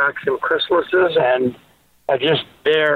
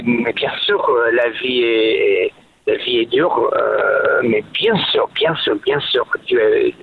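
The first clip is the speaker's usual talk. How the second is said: lower, grandiose French dad; 4 semitones lower than usual. Pretend. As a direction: lower voice, pompous French speech. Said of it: "what do you think it's like to be a French dad".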